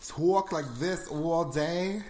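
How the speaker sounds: slow, broad Jersey accent. Shown as a demonstration. Showing which accent is preferred, slow Jersey accent. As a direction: lean hard into a drawn-out Jersey accent. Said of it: "I like Jersey accents more".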